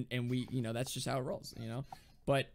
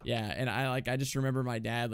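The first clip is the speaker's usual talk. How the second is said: ordinary delivery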